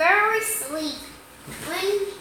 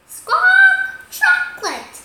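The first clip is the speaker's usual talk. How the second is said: loud, high parrot squawk; 11 dB louder, slower than usual. Acted. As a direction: screech the word back like a parrot. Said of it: "Polly repeats".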